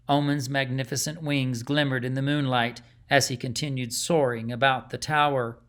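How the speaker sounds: ordinary delivery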